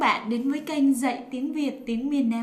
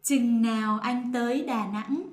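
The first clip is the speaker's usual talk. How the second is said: standard northern Vietnamese pronunciation. Shown as a demonstration. Partner answering in northern Vietnamese standard. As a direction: say it the Hanoi northern way. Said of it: "me will say it in the non-dialect".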